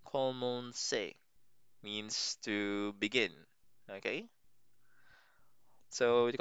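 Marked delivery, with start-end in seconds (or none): 0.0-1.1 "Commencer" said slowly, ko-mon-SAY, falling; drawn-out model of "Commencer", ko-mon-SAY; French commencer. Shown as a demonstration. Teacher slowly modelling "Commencer" for learners. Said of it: "this came from commencer".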